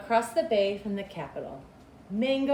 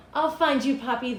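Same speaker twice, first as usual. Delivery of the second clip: put-on higher, louder boy's vow; determined little boy; 4 semitones higher, 6 dB louder than usual. Boy holding his father's photo, vowing to find him. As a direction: promise it like a brave child.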